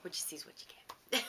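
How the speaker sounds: soft whispered aside. Real, not acted. Whispering to listeners that what you see is what you get. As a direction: breathe it out as a secret. Whispered punchline about herself.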